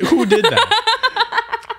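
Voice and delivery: high, stretched-out disbelief; confused shock. Retold or imitated. Waking up in school, stretched high baffled question. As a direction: drag it out, baffled and laughing. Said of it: "I'm like".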